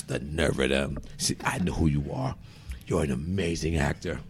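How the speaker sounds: low, soft imitated aside; confiding salesman. Salesperson at register praising actor, confiding. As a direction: drop low and confide your admiration.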